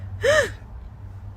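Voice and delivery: high, drawn-in horrified gasp; sharp gasp. Retold or imitated. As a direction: gasp in mock horror at the offer. Acted reaction to ambulance offer.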